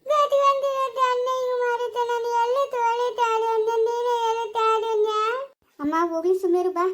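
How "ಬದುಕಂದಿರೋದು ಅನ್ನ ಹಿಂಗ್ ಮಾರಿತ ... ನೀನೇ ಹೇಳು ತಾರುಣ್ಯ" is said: high, slow keening complaint; crying lament. Acted. Woman telling Tarunya of betrayal, wailing tearfully. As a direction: sob and chant the grievance on one raised note. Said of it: "ಅಳ್ಬೇಡ ನೀನು".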